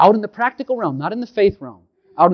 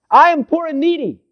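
high, loud plea of David; David crying out; 12 semitones higher, 4 dB louder than usual. Retold or imitated. King David crying out in the Psalms, high plea. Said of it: "David cried out".

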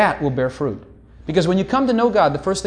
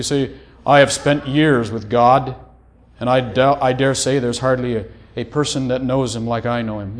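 mock pious boast; 5 semitones lower than usual. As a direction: parody a smug super-spiritual saint. Mocking a proud mystic.

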